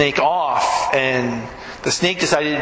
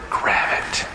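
whispered command; 3 dB quieter than usual. Acted.

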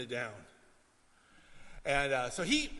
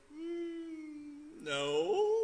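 high, guilty mumbled no; sheepish denial; 7 semitones higher, 6 dB quieter than usual. Retold or imitated. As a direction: play the caught little brother. Brother denies touching pudding.

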